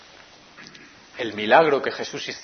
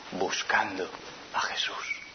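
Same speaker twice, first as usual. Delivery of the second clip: whisper; 8 semitones lower, 15 dB quieter, slower than usual. Acted. Predicador susurra en tensión dramática.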